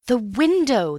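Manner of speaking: loud urgent shout of window; crying out. Acted. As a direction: point and shout it out. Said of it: "cried Antony".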